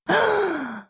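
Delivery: sharp high gasp; shocked gasp. A narrator's reading. Hall gasps in shock.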